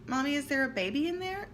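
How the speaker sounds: a hint of a curious little son; child's voice. Son asking mommy about a baby, lightly voiced child.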